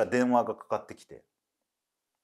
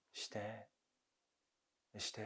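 indistinct muttering; 17 dB quieter, much slower than usual. Acted. Women round the futon in a nightmare, muttering indistinctly. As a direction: murmur it, barely audible. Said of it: "何ボソボソ言ってんの".

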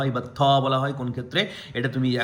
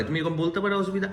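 normal talking voice

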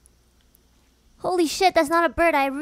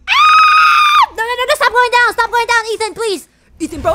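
panicked shouting; 10 semitones higher, 17 dB louder than usual. Real, not acted. Character falling, panicked yelling.

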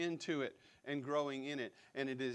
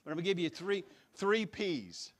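louder, rising revival preaching cadence; Southern Baptist preacher; 4 dB louder than usual. Real, not acted. Pastor announcing his three points in booming preacher style. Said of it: "I turned into a Southern Baptist preacher".

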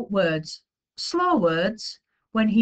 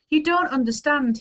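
high-pitched frustrated outcry; distressed, pitched high; upset cry; 7 semitones higher than usual. A narrator's reading. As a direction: cry out as a misunderstood child. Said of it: "he cried".

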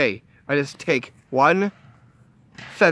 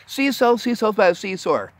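fast tongue twister; 6 semitones higher than usual. Shown as a demonstration. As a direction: rattle it off quickly as a tongue twister. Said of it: "you can say it fast".

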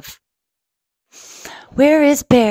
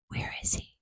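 very soft, whispery wondering; hushed question; 20 dB quieter than usual. Acted. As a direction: ask it under your breath. Hushed aside during the search.

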